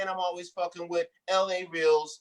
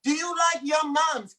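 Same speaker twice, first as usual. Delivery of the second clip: hype-man crowd call; 8 semitones higher than usual. Acted. Host hyping the crowd.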